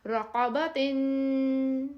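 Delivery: رقبتن very slowly, final nasal held long; with ghunnah. Shown as a demonstration. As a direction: hum the ghunnah at the end of رقبتن. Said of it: "ادھر ہم غنہ کر سکتے ہیں". (رقبتن پر غنہ کے ساتھ آہستہ)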